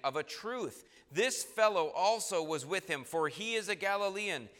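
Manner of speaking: confidently affirming. A narrator's reading. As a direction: affirm it firmly, voice lifted. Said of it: "another confidently affirmed".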